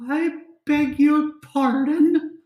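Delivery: high, slow affronted gasp; offended lady voice. Acted. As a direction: act scandalized like a noble.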